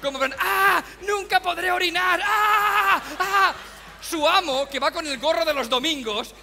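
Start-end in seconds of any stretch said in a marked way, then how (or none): 0.4-3.5 suffering cries. Retold or imitated. Obese pet struggling to pee, high anguished wailing. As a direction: wail in agony, can't pee. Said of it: "cara de sufrimiento del ser".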